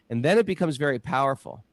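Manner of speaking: ordinary talk, nothing marked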